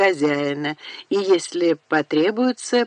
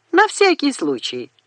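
higher-pitched, lightly amused reply; mild chuckling reply; 8 semitones higher than usual. A narrator's reading. Charlie answers her on the stairs, lightly amused. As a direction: toss it off with a hint of a chuckle. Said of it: "хохотнул тот".

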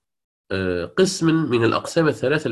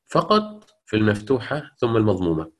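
usual speaking voice

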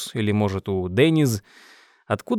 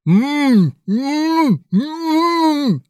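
high, strained humming through a sewn-shut mouth; muffled hum; 14 semitones higher, 4 dB louder, much slower than usual. A narrator's reading. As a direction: hum with lips sealed, desperate. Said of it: "сказать он мог только".

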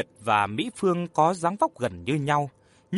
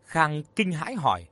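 ordinary delivery, nothing marked

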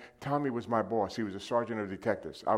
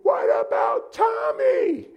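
full-throated scream, much higher and louder; a desperate yell to God in the car; 12 dB louder, much slower than usual. Retold or imitated. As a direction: scream it slowly at the top of your lungs. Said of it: "I scream at the top of my lungs".

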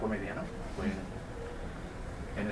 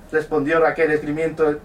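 ordinary delivery

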